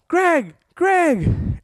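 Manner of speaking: high-pitched call to get someone's attention; retold high call-out; calling out a name. Calling down to Greg from above.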